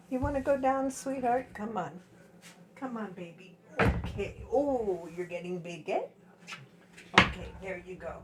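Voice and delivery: high, sweet pet talk; cooing to puppy. Real, not acted. Talking to her puppy.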